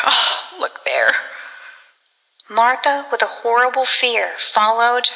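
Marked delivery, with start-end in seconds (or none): 0.1-1.3 slow, horror-struck warning; horrified gasp. A narrator's reading. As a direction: drop low as you see the ghost. Terrified woman sees a ghost.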